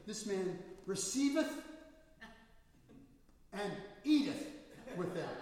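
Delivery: put-on loud, high proclaiming of the old scripture; King James reading. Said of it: "right out of King".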